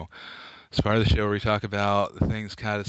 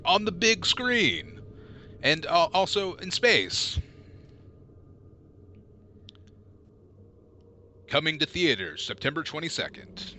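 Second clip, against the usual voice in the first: movie trailer voice; 5 semitones higher than usual. Acted. Trailer announcer closing.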